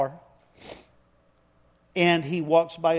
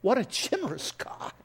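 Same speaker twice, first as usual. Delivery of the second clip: high, soft, choked-up awe; fighting back tears; 5 semitones higher, 6 dB quieter than usual. Real, not acted. Preacher moved by his own story, fighting back tears. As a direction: hold back tears on a hushed exclamation. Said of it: "Now I'm fighting back tears".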